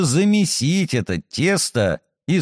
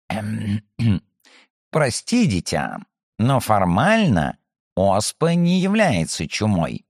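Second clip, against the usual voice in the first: lower, pedantic, throat-clearing lecturer; professorial tone; 4 semitones lower than usual. Acted. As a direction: correct her like a fussy professor. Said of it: "профессорским тоном ответил".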